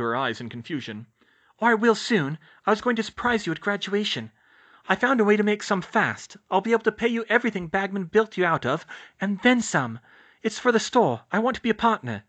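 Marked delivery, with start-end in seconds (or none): 1.6-12.2 high, eager, raised voice; keen high-voiced confession; earnest young witch. A narrator's reading. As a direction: explain it urgently, pitched up. Hermione explains her plan.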